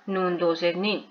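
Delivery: normal talking voice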